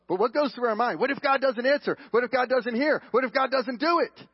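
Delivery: high, loud string of worried questions; anxious doubting. Acted. Preacher voicing the doubts that go through our mind, anxious.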